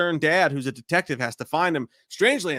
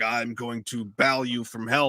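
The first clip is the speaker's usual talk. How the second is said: low, slow, menacing delivery; deep, drawn-out mock villain; weird deep villain voice; 5 semitones lower than usual. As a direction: intone it like a creepy movie villain. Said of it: "like, deep voice".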